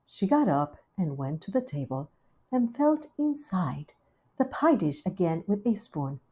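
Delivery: plain reading voice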